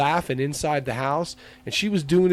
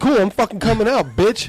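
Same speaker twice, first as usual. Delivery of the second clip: high-pitched mock bravado; heated reply, pitch raised; retold defiant comeback; 6 semitones higher than usual. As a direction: take up the challenge, worked up. Retelling own angry reply to caller.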